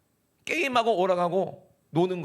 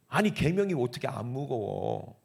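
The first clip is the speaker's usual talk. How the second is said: ordinary talk, nothing marked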